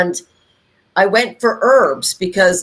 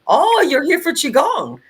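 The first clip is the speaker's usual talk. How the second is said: put-on Chinese accent; 9 semitones higher than usual. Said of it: "in her little Chinese accent".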